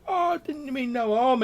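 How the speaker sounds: put-on high, pleading young lad; whining youth. Caught youth protests to the guard, high whining. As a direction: whine your innocence. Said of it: "this youth's like".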